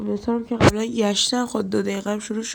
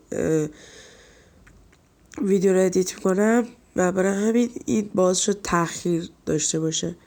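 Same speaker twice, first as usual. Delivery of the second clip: usual speaking voice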